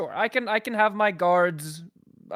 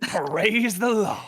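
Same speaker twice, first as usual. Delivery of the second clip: mock preacher; 4 semitones higher, slower than usual. Cletus preacher exclamation.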